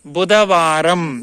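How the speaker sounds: slow, spelled-out బుధవారం. Shown as a demonstration. Teacher showing the class the slow way. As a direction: say బుధవారం slowly, every syllable clear. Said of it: "స్లోగా చెప్పాలి".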